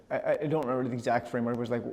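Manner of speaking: normal talking voice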